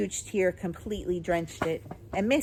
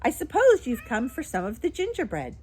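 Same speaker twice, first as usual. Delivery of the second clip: put-on higher, louder old woman; chatty old shopkeeper; 6 semitones higher, 5 dB louder than usual. A narrator's reading. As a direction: greet a familiar customer briskly. Voices Miss Corey.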